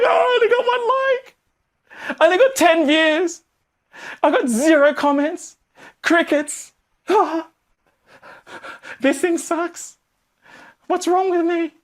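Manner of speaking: put-on high, slow childish sobbing whine; hammed-up crying over one like; mock crying. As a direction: wail for Mummy like a toddler. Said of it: "Why am I crying to Mummy for?".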